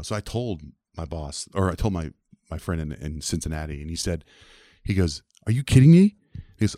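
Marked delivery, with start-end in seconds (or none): 5.4-6.1 loud, high-pitched disbelief; incredulous. Retold or imitated. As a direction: burst out as the disbelieving friend. Friend reacts in disbelief.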